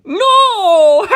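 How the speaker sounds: high shrieking yell with laugh; tiny people yelling. Acted. Tiny bullet riders yelling no at Doppio. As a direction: shout like tiny madcap creatures. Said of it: "in deep, hoarse voices".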